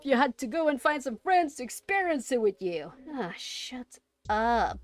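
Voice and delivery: smug mocking voice from the wall; taunting wall face. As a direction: gloat down at them like a sneering wall.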